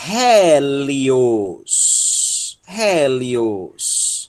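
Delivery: HÉLIOS drawn out with a breathed H at the start; stretched teacher's model of "Hélios", H sounded; aspirated pronunciation. Shown as a demonstration. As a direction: say "Hélios" slowly, puffing the initial H. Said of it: "A aspiração forte".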